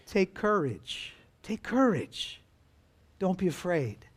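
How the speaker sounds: soft, slow, hushed words of comfort; calm a terrified friend quietly; gentle reassurance. Acted. Jesus to the panicking disciples, hushed and reassuring.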